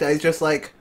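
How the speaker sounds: ordinary delivery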